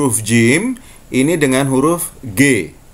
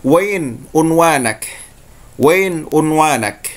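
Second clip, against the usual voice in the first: slow Saudi "wain unwanak", said twice; Saudi Arabic; slower than usual. Shown as a demonstration. Teacher showing students the Saudi dialect phrase. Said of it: "Saudi, mereka mengatakan".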